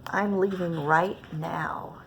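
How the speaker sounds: usual speaking voice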